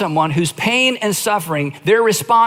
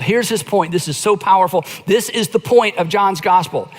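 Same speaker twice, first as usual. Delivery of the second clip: ordinary talk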